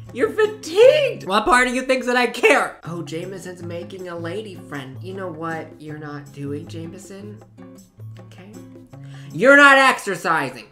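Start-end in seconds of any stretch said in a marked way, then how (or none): 0.1-2.8 shouted much higher, scornful; mocking shout. Yelling at tired sim. 9.3-10.7 loud scolding shout. Real, not acted. Yelling at slacking sim.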